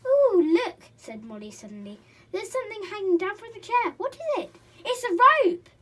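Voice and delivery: high, loud surprise; children exclaiming; excited children. A narrator's reading. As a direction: spot something thrilling.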